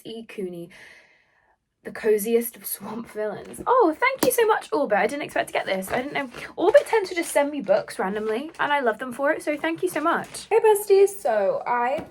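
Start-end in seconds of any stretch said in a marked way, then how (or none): 3.7-5.0 high, loud delighted thank-you. Real, not acted. Surprised by gifted book mail. 10.5-11.1 bubbly greeting. Real, not acted.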